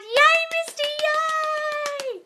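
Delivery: squealed, very high yay; excited cheer. Real, not acted. Cheering Misty whose call is tonight, squealing. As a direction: cheer her on.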